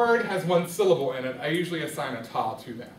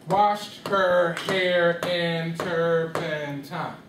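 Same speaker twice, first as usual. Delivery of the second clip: Washed her hair in turpentine, very slow, every syllable held equally long; one beat per syllable; much slower than usual. Shown as a demonstration. Teacher showing students too many beats, one beat per syllable. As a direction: chant seven long even beats, WASHED-HER-HAIR-IN-TUR-PEN-TINE. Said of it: "that's how many beats?".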